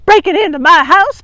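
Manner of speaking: loud puffing, breathless; out of breath. Acted. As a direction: huff it while chasing. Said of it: "puffed Grandma".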